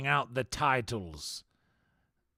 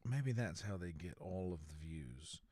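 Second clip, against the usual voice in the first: hushed conspiratorial; 5 semitones lower, 10 dB quieter than usual. Pretend. Conspiratorial musing.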